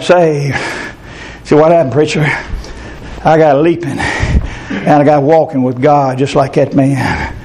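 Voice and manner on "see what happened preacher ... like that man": ordinary delivery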